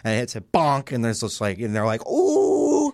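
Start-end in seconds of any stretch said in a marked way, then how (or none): 2.1-2.9 high, held "ooh" of pain; long comic hoot of pain; mock pained ooh. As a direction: imitate a slapstick victim's high ooh. Imitates comedians getting hit.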